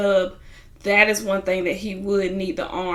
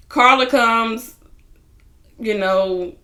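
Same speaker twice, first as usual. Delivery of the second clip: usual speaking voice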